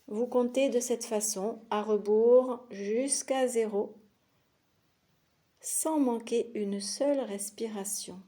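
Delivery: usual speaking voice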